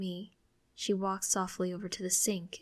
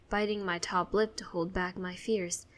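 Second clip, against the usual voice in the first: usual speaking voice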